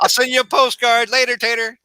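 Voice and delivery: high, bright mock hero goodbye; hammed-up Spider-Man exit; giddy Spider-Man. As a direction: wave it off cheekily. Continuing Spider-Man bit.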